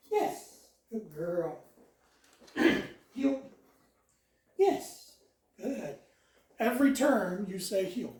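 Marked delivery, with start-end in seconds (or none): 0.1-0.7 high, bright marker "Yes" then praise. Real, not acted. Trainer rewarding the dog for sitting, high and excited. 4.6-5.1 high, bright marker "Yes" then praise. Real, not acted. Trainer rewarding the dog for sitting, high and excited.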